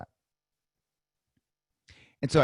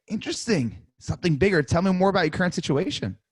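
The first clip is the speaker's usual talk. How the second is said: higher, intrigued; interested agent tonality; 6 semitones higher, 4 dB louder than usual. Shown as a demonstration. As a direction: sound genuinely intrigued. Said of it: "Hear my tonality".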